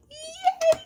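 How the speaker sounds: very high squeal; excited squeal. Real, not acted. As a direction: squeal with joy. Celebrating the total.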